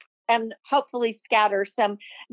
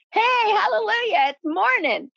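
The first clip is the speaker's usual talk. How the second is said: higher, bright cheer of hallelujah; joyful cheer; 8 semitones higher than usual. Pretend. Speaker voicing someone waking up glad, joyful cheer. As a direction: greet the new day like good news.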